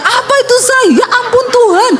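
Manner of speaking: high startled cry to husband; panicked call to husband when the exhaust falls. Retold or imitated. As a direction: shriek in alarm at the clatter. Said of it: "Saya teriak".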